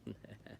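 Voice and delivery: short, soft chuckle; laugh. Real, not acted. Reader amused by the stew poem, brief soft chuckle. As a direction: give a small quiet laugh.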